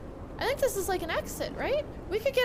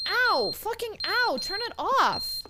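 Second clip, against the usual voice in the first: slow, drawn-out pained complaint; pained groan; 4 dB louder, slower than usual. Real, not acted. Player begging the shrieking smoke alarm to stop, slow and pained. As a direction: drag it out in annoyed pain.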